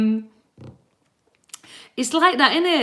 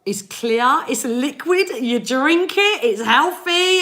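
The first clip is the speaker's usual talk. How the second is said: higher and louder, pleading description; getting more insistent with each clue; explaining to a stranger; 5 semitones higher, 3 dB louder than usual. Retold or imitated. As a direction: describe water to someone who doesn't get it. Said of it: "I was like".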